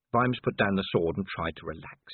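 ordinary delivery

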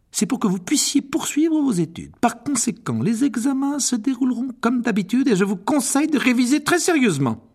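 voix aiguë et ferme de professeure; professeure sévère. Acted. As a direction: avertir la classe sans appel. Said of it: "en fronçant les sourcils". (professeure sévère)

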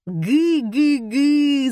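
loud, high, drawn-out mock guffaw; crowd guffaw. A narrator's reading. Audience braying at the assistant's answer, guffawing. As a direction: bray with exaggerated laughter. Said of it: "заржала публика".

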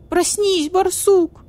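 high, loud squeaky cry; voice cracking into squeak. Acted. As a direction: voice breaks into a kitten's squeak. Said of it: "Голос барсукота сорвался на писк".

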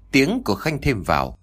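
ordinary delivery

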